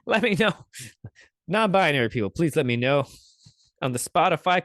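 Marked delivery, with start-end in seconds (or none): 0.1-0.5 laughing through the repeat. Real, not acted. Streamer repeating her request to chat, laughing. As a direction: let the laugh carry the words.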